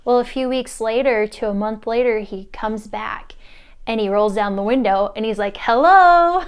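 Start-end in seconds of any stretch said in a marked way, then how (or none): 5.6-6.4 loud, high singout hello; calling out. Retold or imitated. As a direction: call from the car window. Calling from car window.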